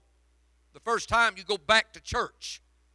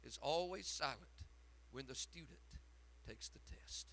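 hushed whisper; 4 semitones lower, 20 dB quieter than usual. Retold or imitated. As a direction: whisper God's words, low and breathless. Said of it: "he said".

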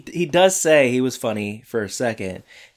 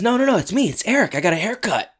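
excited high voice; 10 semitones higher, faster than usual. Retold or imitated. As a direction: blurt it out excitedly. Eric acted excitedly.

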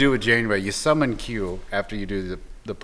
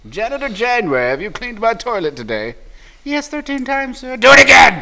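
high squeaky bossy impression; mock Janeway voice; 11 semitones higher, 9 dB louder than usual. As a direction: play the boss, shrill and high. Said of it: "That's not her voice".

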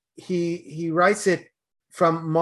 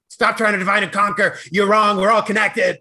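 mock preachy scolding; 4 semitones higher, 5 dB louder than usual. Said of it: "as opposed to".

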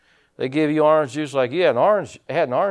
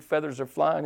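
normal talking voice

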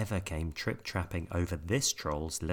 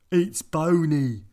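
higher, louder, slow complaint; disgusted pirate; 8 semitones higher, 9 dB louder, slower than usual. A narrator's reading. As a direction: complain about the soup. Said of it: "said Ben Buckle".

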